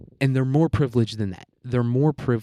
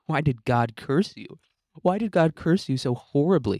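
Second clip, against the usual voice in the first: higher, mocking sympathy with a laugh; sarcastic pity; 5 semitones higher than usual. Real, not acted. Mocking the whining rich actor with sarcastic pity, pitched higher. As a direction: pour on fake pity.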